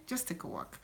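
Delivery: repeated in a soft whisper. Real, not acted. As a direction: whisper it as a gentle nudge.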